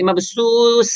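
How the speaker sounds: مبثوث with a whistling s for th; wrong whistling s. Shown as a demonstration. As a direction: demonstrate the mistake: مبثوث ending in a long sss. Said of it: "سیٹی کی آواز نہیں نکالیں گے".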